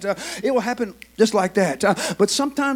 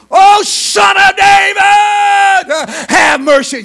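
loud, higher desperate plea, drawn out slowly; crying out for mercy; 12 semitones higher, 15 dB louder, much slower than usual. Acted. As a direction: beg at the top of your lungs. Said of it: "he began to cry out".

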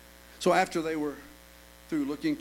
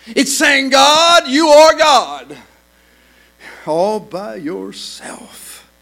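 louder and higher, rising gospel preaching; full-force preaching; 9 semitones higher, 15 dB louder than usual. Acted. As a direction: preach it out with mounting fervor.

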